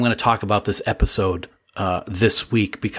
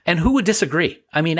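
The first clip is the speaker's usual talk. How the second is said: usual speaking voice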